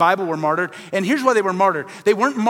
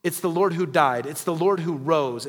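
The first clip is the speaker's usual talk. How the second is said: ordinary talk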